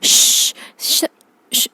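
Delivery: repeated sharp "shh"; string of hissed shushes; urgent shushing. Acted. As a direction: hush the whole room. Mother hushes the room.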